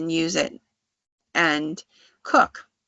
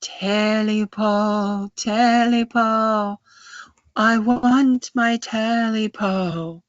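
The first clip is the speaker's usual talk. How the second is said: long, drawn-out eerie call, pitched up; loud creature calling; 4 semitones higher, 3 dB louder, much slower than usual. Acted. The thing downstairs calls for its Telipo, loud drawn-out wail. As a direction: call out slowly, holding each word like a haunting chant. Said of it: "it's calling s-, loudly".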